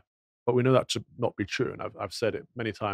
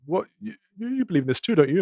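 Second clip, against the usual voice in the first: a higher, fast mock of Bill; 9 semitones higher, faster than usual. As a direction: imitate him roping someone in, lightly.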